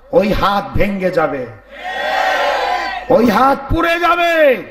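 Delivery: ordinary talk, nothing marked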